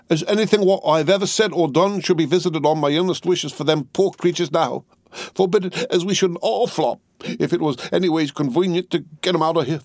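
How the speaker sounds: high, grave pulpit voice; solemn preaching. A narrator's reading. Cruncher holds forth to Miss Pross, solemn sermon. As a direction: hold forth and hold out. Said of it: "additional solemnity, additional slowness".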